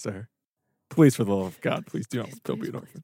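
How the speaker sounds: soft desperate plea; pleading whisper. Acted. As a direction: beg him quietly. Pleading with the onion man.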